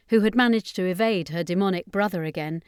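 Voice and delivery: ordinary delivery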